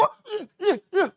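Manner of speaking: grunting under a heavy copier; mock straining grunts. Teacher acts out student lugging the copier, straining. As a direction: mimic hauling something heavy, huffing.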